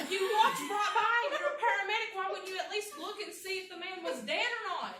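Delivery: high, loud indignant scolding; retold angry outburst. Speaker scolds the paramedic who walked by, mad. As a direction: re-enact telling someone off, starting at full volume and easing slightly. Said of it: "I was so mad".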